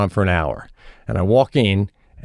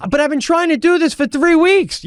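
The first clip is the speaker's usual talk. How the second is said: very high, wailing protest; frustrated daughter; 3 dB louder than usual. Retold or imitated. Daughter pleading after weeks of trying, very high frustrated cry. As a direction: protest in a strained high kid voice. Daughter arguing in retold quarrel.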